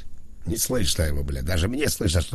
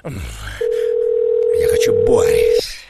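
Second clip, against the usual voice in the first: drawn-out whine; 6 dB louder, much slower than usual. Acted. Drawn-out plea for the club.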